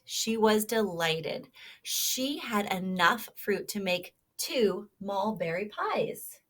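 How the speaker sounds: lively storybook read-aloud; reading to children. Acted. Teacher reading a kindness story to children, animated. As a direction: read it like a picture book to little listeners.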